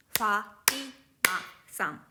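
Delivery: "Fatima-san" broken into even beats, Fa, ti, ma, san; "Fatima-san" spaced out syllable by syllable; mora by mora. Shown as a demonstration. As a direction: give each syllable of "Fatima-san" equal length. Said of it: "the same length of the letter".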